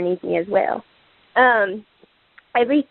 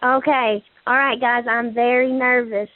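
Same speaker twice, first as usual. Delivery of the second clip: ordinary talk, nothing marked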